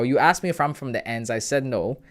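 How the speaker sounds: ordinary talk, nothing marked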